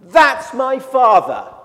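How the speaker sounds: loud, slow, high cry of recognition; joyful shout. Retold or imitated. Little girl spotting her father at the airport, joyful shout. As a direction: call it out with pure delight. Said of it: "she says".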